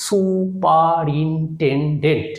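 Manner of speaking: Superintendent drawn out very slowly as সু-পা-রিন-টেন-ডেন্ট; syllable by syllable. Shown as a demonstration. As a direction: say superintendent in five even, separate beats. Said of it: "তাহলে কি বললাম?". (Superintendent ধীরে, syllable ভেঙে ভেঙে)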